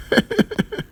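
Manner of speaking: high, breathy chuckle; amused wheezy giggle; real laugh. Real, not acted. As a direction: laugh softly, high and airy. Amused while commenting on article.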